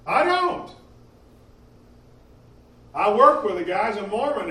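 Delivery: normal talking voice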